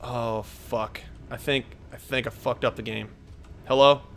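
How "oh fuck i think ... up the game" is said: low, hushed dismay; real dismay. Real, not acted. Player realizing he may have broken the game, quiet dismay. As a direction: say it quietly to yourself, sinking.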